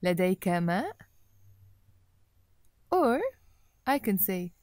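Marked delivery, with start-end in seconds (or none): 0.0-1.0 لديك ماء with the voice rising on ماء; question tune. Shown as a demonstration. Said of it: "Notice how I changed my voice".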